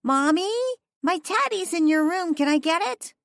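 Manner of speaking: put-on high child voice calling from the hall; high, eager little-girl voice. A narrator's reading. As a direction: call out like a small girl asking for her teddy. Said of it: "Liddy called out".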